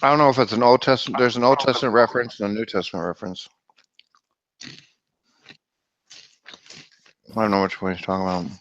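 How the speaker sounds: talking while chewing chips; crunching chips. Real, not acted. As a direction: mouth full of potato chips. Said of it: "Can you hear me chewing my potato chips?".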